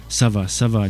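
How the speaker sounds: usual speaking voice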